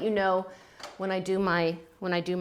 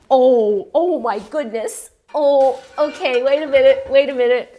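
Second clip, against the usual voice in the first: gasp of surprise; 4 semitones higher, 9 dB louder than usual. Real, not acted. Spotting a lovely bowl on the shelf, loud surprised gasp. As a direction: gasp and stare in delighted disbelief.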